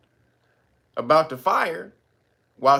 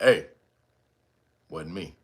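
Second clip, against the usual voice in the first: very low, much softer deadpan "wasn't me"; low deadpan denial, barely above a murmur; straight-faced denial; 8 semitones lower, 3 dB quieter than usual. Retold or imitated. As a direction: mumble it low with a straight face. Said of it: "say it with a straight face".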